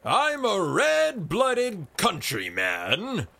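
slow, loud, high protest; crying out. A narrator's reading. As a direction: cry it out with mock outrage. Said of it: "he cried".

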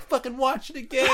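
high-pitched mock commentator urging a replay; a lightly hammed-up commentator's call; Joe Rogan. Mocking the commentator over the replay, eager high voice. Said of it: "Joe Rogan's like".

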